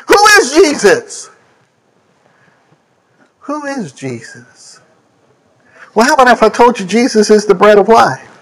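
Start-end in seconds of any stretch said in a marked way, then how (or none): 0.1-1.3 called out high and ringing; ringing question. Acted. Preacher asking the congregation, raised and ringing. 3.4-4.8 much softer and slow, almost to oneself; hushed question. Acted. Preacher repeating to the congregation, hushed and slow.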